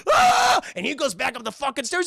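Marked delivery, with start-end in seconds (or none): 0.1-0.6 very high mock scream; loud cartoon shriek of fright; frightened scream. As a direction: imitate a terrified dog screaming. Dog screams at monster.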